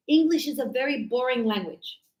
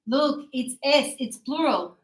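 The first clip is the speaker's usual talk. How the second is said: ordinary talk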